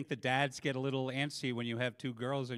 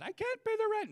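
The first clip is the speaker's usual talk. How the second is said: very high falsetto mock damsel; hammed-up falsetto plea; damsel in distress. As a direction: squeal it like a helpless cartoon heroine. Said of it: "tying the young girl".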